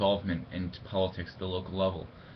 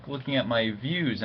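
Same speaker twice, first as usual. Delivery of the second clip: usual speaking voice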